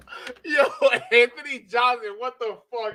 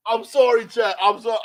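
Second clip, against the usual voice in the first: ordinary talk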